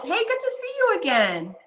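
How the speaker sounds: high, bright excited hello; high happy welcome; excited greeting. Real, not acted. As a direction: greet her with a lift.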